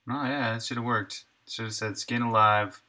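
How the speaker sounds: ordinary talk, nothing marked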